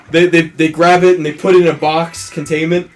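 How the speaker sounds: ordinary talk, nothing marked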